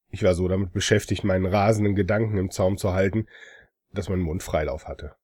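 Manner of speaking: ordinary delivery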